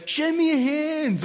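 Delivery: high, drawn-out playground voice; child's demand. Retold or imitated. Childhood friend checking for crossed fingers, high mock kid voice. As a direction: put on a high kid's voice, stretching the words.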